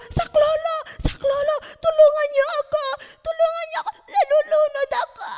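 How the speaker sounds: high, loud, frantic cries for help; screaming for help. Acted. Teacher acting a drowning girl calling for help, frantic high screaming.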